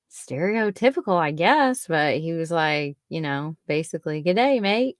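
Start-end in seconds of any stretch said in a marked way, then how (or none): 4.2-5.0 lightly voiced Aussie greeting; Australian accent. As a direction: toss off a mild Aussie accent. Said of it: "do his accent".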